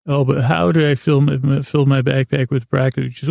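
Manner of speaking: normal talking voice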